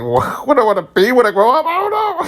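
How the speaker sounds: put-on squeaky, rushed voice of a lost kid; high-pitched flustered quote. Asked about his future, squeaking out a flustered reply. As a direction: voice jumping up, not knowing the answer. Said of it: "I get emotional when people ask me that".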